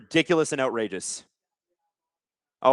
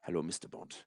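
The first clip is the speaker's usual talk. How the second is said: hushed, low Bond villain voice; sinister villain greeting, barely above a murmur; 9 semitones lower, 19 dB quieter than usual. Acted. Streamer imitates the coach as Bond villain, hushed and low. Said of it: "There's the Bond villain Moroccan head coach".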